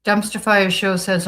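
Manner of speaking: normal talking voice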